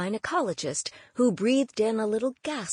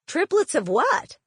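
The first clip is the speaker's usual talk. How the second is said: baffled question; 8 semitones higher, 5 dB louder than usual. Acted. Aunt baffled by the news.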